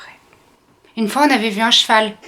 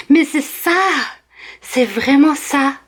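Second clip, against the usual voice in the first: gushing; 6 semitones higher, slower than usual. Acted. Over-the-top agreement.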